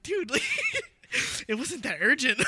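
cracking up mid-line; amused retelling with laughter; laughing. Retold or imitated. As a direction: laugh through the reassurance. Laughing retold reassurance.

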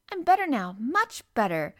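put-on high, loud, imperious boy; lordly young Rajah. A narrator's reading. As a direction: declare it grandly like a little prince. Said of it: "rather like a Rajah".

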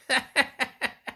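put-on high, ugly cackle of an old hag; nasty witch cackle. A narrator's reading. Old witch cackling at the bold boy, high and nasty. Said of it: "lachte widerlich".

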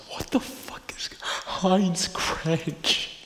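slow, breathy disbelief; stunned hushed question; incredulous whisper. Real, not acted. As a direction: whisper it like you can't believe it. Baffled reading a weird post.

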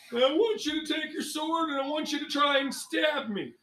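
high, lightly Farley-tinged instructor voice. Acted. DM as instructor Grim challenging the ranger to stab him. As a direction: challenge the student to attack you.

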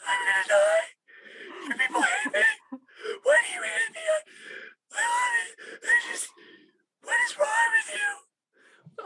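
shrill falsetto wail, crying and shouting; squeal crying man. Retold or imitated. Mocking a producer who sobs over one gobo, high squealing cry. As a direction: wail it like a grown man falling apart. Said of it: "he's just like".

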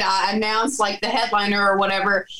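normal talking voice

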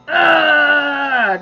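long, high exasperated groan; drawn-out "uhh" of frustration; frustrated growl. Acted. Preacher listing anger to her viewers, exasperated groan.

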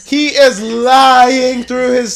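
loud high outraged yell, dragged out; shouting at the screen. Real, not acted. Yelling at the Bachelor on TV. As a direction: bellow the accusation at the TV.

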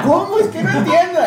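high laughing disbelief. Real, not acted. Laughing at the bad riddle.

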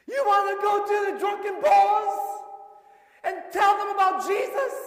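loud, high, building preaching; preaching at full force. Acted. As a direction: drive the story home to the congregation.

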